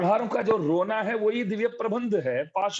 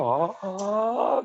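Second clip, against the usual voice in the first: mock groaning; 2 semitones higher, much slower than usual. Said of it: "करके बहुत करता है".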